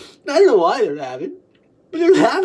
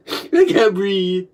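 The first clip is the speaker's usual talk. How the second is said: ordinary delivery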